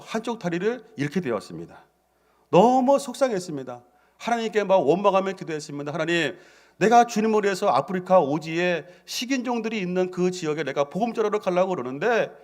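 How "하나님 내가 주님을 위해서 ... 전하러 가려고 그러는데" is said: retold anguished prayer to God; lamenting prayer. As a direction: cry out to God in complaint. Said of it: "막 한탄하고 기도하고".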